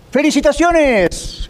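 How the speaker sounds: exaggerated, singing-out congratulations; mock congratulations. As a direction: hail it slowly, stretching the word out. Mock congratulating the train from the platform.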